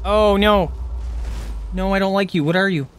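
recoiling from a creature on screen; scared. Real, not acted. Startled by a monster, alarmed.

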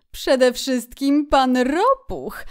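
high, loud, slow otter voice; Otter, emphatic gossip. A narrator's reading. Otter tells Rat who is on the river, emphatic. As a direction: announce the name with relish. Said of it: "odparła wydra".

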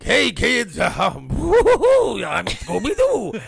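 high, slow, hammed-up Scooby-Doo voice for the kids; cheesy kids' entertainer. A costumed performer greets kids at a party, hammed-up and high. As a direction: ham it up as a bootleg Scooby-Doo.

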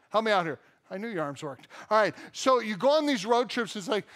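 ordinary talk, nothing marked

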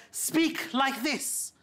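very slow, clipped colonial command; posh British instruction. Retold or imitated. Colonial officer to Indians, slow posh command. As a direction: lay each word out slowly like a stern colonial tutor. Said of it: "told us".

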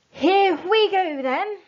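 high, loud driver's shout. Acted. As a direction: yell it over the engine. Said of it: "shouted Bean".

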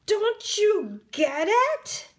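high and slow, talking down; exasperated. Acted. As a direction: spell it out like he's slow. Mole rats scolding Wilbur.

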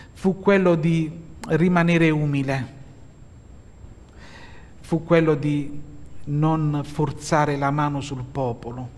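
ordinary talk

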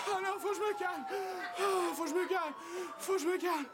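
high, distressed self-soothing; panicked self-talk. Acted. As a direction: plead with yourself in a high voice. Tries to calm self after cries. (panique aiguë, en se parlant à soi-même)

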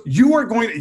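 insist, no arguing; mock stern wife; firm insistence.

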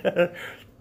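high loud embarrassed laugh; burst of laughter. Real, not acted. Laughing at camera too close.

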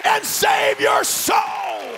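full-force preaching. Real, not acted. Preacher calling the congregation to stand, full-force shouting climax. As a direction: preach it to the rafters, building to the altar call.

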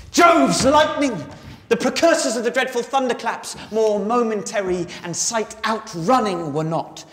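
loud high bursts, hushing and slowing between; Ariel, giving the whole ship. Acted. Ariel running round a huge ship in the storm, exhilarated. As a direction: build big, stretch the final words into a cry. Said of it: "give us a sense of the whole ship".

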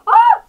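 short high, loud shriek; sudden yelp of alarm; startled scream. Real, not acted. Player jumping on the meteor as something happens, startled scream.

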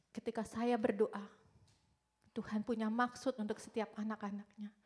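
ordinary talk, nothing marked

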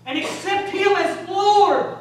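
loud, high-pitched exhortation; full-force preaching. Real, not acted. Preacher urging sinners to come before God, full-force preaching. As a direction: drive it home to the whole church.

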